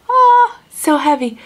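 surprised oh. Real, not acted. Lifting shoe box, surprised.

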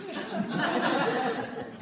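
loud high burst of laughter; long incredulous laugh that falls away; high disbelieving cackle. Acted. Men react to wives arriving.

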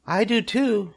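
very high, small voice; tiny creature chiming in; Arania the little spider. A narrator's reading. Second spider joins the pledge, high voice. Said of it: "said Arania".

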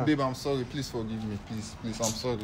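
soft, pleading apology; cringing submission. Real, not acted. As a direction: beg her forgiveness quietly. Caught cheater begging girlfriend.

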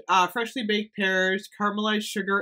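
ordinary talk, nothing marked

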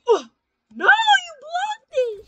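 stretched-out, very high and loud wail; outraged scream. Real, not acted. Gamer screaming at his friend's block, drawn-out high wail. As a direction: scream at the screen in disbelief.

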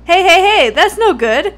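shouting hey hey hey; loud, indignant objection; loud protest. Acted. Classmate objecting loudly.

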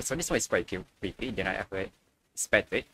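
ordinary talk, nothing marked

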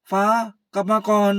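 high, loud plea to come back; husband calls after his departing wife; calling after her. Acted. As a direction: shout it at her back as she leaves.

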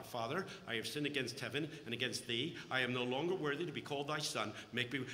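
quiet rehearsed apology. Retold or imitated. Prodigal son practising his speech to his father, quiet and meek. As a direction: mumble the prepared speech meekly.

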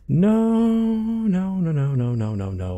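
high panicked no's settling down. Real, not acted. Scared gamer pleading at a jump scare, panicked. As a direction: start high in panic then calm down.

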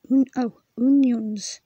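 onions said firmly as the corrected Welsh form; corrected pronunciation. Shown as a demonstration. Learner corrects herself loudly on onions. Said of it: "That's pronounced O".

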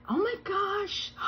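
high, slow oh my gosh; drawn out in awe; amazed gasp. Real, not acted. Seeing the transfers.

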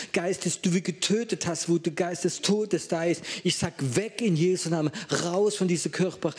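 preacher rebuking the spirit of death before the church, in a forceful preaching voice; preaching at full force. Acted. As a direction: cast the spirit out with full authority. Casting out the spirit of fear.

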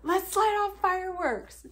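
rally the crowd mockingly; mock cheering.

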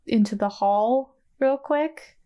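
ordinary delivery

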